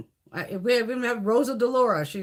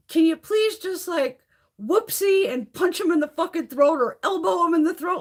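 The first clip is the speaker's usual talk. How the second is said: high-pitched, emphatic comic plea; mock pleading; 9 semitones higher, 4 dB louder than usual. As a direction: beg in a hammed-up high voice. Comic plea to congressman, acted.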